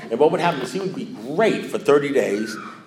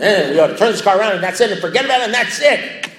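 blowing up; 7 semitones higher, 5 dB louder than usual. Retold or imitated. Finally blowing up at sponsor.

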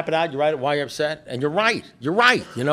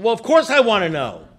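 high, louder exasperated comeback; impatient retort; 5 semitones higher, 4 dB louder than usual. Retold or imitated. Exasperated man answering his sponsor, impatient retort. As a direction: jump in impatiently, pitch leaping up.

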